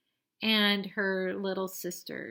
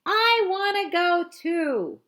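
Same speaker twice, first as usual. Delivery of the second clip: put-on high, loud and slow toddler wail; drawn-out scream; 9 semitones higher, 8 dB louder, slower than usual. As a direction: stretch out the demand like a tantrum. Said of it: "Santa screams".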